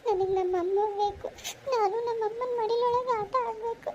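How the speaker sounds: high-pitched, sobbing plea; childlike pleading through sobs; crying. Acted. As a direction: wail for a lost mother. Motherless child cries for her mother.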